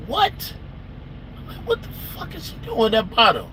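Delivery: high-pitched mock astonishment; retold incredulous questioning; shocked disbelief. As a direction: voice leaps up, baffled. Retelling his shock at the bottle.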